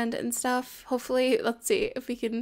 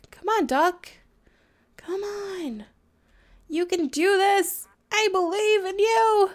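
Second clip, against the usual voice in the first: high, slow sing-song encouragement; coaxing a pet duck onward; sweet coaxing; 7 semitones higher, 5 dB louder than usual. Pretend. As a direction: coo at the duck like a coach.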